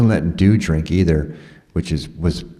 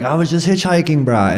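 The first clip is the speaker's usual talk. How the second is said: defensive explanation; 10 semitones higher than usual. Retold or imitated. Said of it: "I was like".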